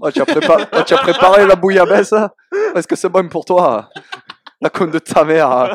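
loud, high, exaggerated Provençal accent. Shown as a demonstration. Southerner performing on request for the host, hammed-up Provençal accent. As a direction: belt out a full-on singsong Provençal accent. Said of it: "ton plus bel accent provençal".

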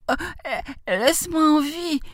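high frightened granny voice; startled, faltering high plea; pleading grandmother. A narrator's reading. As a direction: beg for your life in a high old-lady voice. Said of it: "supplia-t-elle".

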